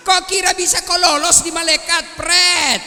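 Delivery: high, loud jeering yell at the corpse; mocking shout. Angel jeering at the dead man, loud mocking shout. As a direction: jeer at him like a triumphant angel.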